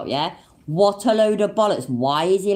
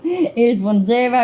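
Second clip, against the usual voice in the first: laughing; 5 semitones higher, slower than usual. Real, not acted. Laughing at the count.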